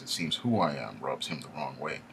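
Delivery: ordinary delivery, nothing marked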